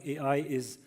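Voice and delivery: usual speaking voice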